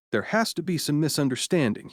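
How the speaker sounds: usual speaking voice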